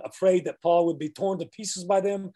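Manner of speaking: ordinary delivery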